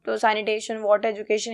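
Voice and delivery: ordinary talk, nothing marked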